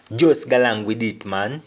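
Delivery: patois, Just galang again; Jamaican patois. Shown as a demonstration. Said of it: "Meaning, just go along with it".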